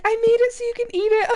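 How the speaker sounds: high, eager imitation of a thrilled friend; excited friend. Coworker's wife excitedly tells her about the switched recipe. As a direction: squeal it like you can't wait to share. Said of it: "she got really excited".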